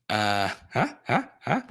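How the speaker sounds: usual speaking voice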